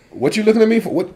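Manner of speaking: high, loud defensive protest; mock indignant protest. Caught out at the dinner table, mock indignant protest. Acting out a defensive family member.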